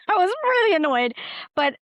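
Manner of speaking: high laughing through the words. Real, not acted. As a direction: laugh it off while admitting annoyance. Laughing at own annoyance.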